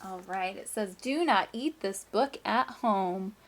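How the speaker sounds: lively, sing-song storybook reading; reading to children. Acted. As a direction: read it like story time for a class. Teacher reads picture book aloud.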